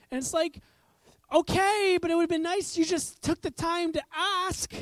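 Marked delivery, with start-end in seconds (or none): 1.3-4.7 high, loud exasperated retort; exasperated parent. Acted. Parent answering child's cheese complaint, exasperated. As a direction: push back at a demanding child.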